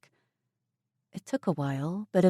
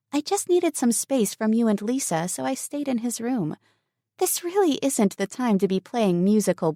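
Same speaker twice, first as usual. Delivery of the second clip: put-on higher, louder young woman, exasperated; a flustered young woman setting him straight; Emily indignant, then firm; 6 semitones higher, 3 dB louder than usual. Emily answers Mason.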